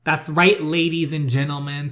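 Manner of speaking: loud showman call; announcing. Pretend. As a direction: proclaim it to the whole room. Showing off to the crowd.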